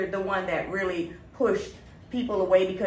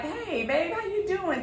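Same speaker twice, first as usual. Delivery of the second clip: sweet greeting; 9 semitones higher than usual. Retold or imitated. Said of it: "You're like".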